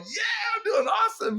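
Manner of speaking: very high, loud, whooping reply; gleeful shout. Retold or imitated. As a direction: celebrate that summer is coming. May, kids going home.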